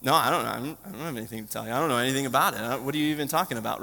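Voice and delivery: fast, soft, mumbled denial; guilty kid denying. Retold or imitated. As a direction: rush out a nervous kid's excuses. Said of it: "what did I answer, guys?".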